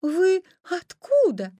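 put-on high, loud, slow child's question; high-pitched little-girl voice; polite little girl. A narrator's reading. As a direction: ask wonderingly as a small girl. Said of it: "вежливо сказала красная шапочка".

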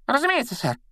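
very high, squeaky flustered compliance; scared imp, high-pitched and servile; frightened. A narrator's reading. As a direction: agree in a panic to appease your master. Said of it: "испуганно пробормотал Пивз".

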